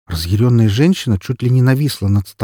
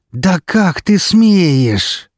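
very slow, high, louder seething hiss; 10 semitones higher, 5 dB louder, much slower than usual. A narrator's reading. Yudina hissing at the blackmailing intern. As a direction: spit out the outrage slowly. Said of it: "зашипела Мария Юдина".

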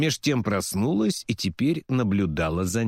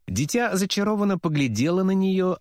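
plain reading voice

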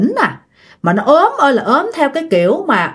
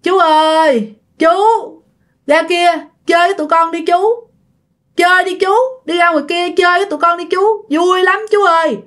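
put-on higher, insistent boy's calling; imitated boy's pleading, raised pitch; normal-voiced ghost boy; 6 semitones higher than usual. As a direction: coax an uncle out to play. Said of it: "ban đầu cái giọng của nó còn bình thường".

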